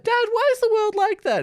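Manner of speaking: put-on high childlike voice asking her dad; little girl voice. Girl asks her father, childlike high voice. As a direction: pitch way up like a curious little girl.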